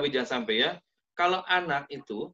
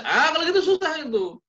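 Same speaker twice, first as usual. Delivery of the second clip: higher, louder exasperated sigh of defeat; exasperated parent; 11 semitones higher, 5 dB louder than usual. Retold or imitated. Mother giving up on maths homework, exasperated. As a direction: throw up your hands, it's hopeless.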